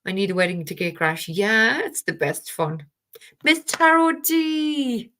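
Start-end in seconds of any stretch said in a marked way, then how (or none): 3.4-5.1 high, slow, drawn-out name call; delighted greeting. Real, not acted. Greeting Miss Tarot D arriving in the chat, high and drawn out. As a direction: sing out her name in delight. Spots a friend joining the stream.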